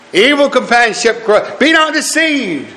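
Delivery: high-pitched, ringing admonition; preacher driving a verse home; emphatic scripture warning. Real, not acted. Recites verse as a forceful warning.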